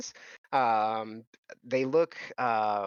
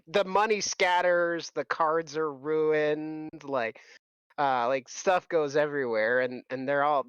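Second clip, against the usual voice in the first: normal talking voice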